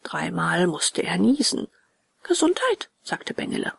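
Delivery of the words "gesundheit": high-pitched bless-you; puppet boy's voice. A narrator's reading. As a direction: call it out high as a little puppet. Bengele calls out after the sneezes. (hohe Puppenjungenstimme)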